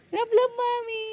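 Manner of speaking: squeaky high coaxing voice. Real, not acted. Mother begging her baby for love, very high baby voice.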